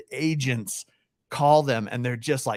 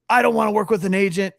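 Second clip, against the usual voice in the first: mock hostile seller; 8 semitones higher, 4 dB louder, faster than usual. Fisbo seller objecting to agent.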